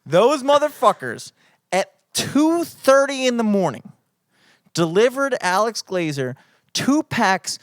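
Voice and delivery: hammed-up fury over the candy cigarettes; mock outrage. Telling a friend about the candy cigarettes, hammed-up loud outrage. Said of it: "I'm furious right now".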